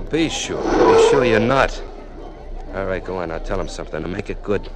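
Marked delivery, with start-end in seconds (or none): 0.0-1.8 louder, slow and pointed; slow firm warning. Acted. Boss presses the nervous candidate, slow and loud. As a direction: lean on each word.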